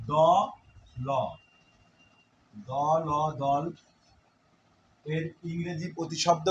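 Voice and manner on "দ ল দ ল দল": দ and ল spoken as separate sounds, then joined slowly into দল; syllable by syllable. Shown as a demonstration. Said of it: "দল আর কিছুই না". (দ ল আলাদা করে, তারপর ধীরে দল)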